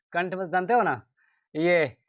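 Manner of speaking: ordinary talk, nothing marked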